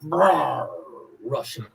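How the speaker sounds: put-on lightly growled bear roar with a Russian accent; a quick mock Russian bear growl. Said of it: "roar in a Russian accent".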